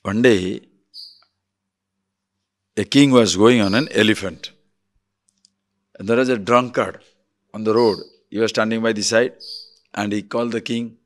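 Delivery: ordinary delivery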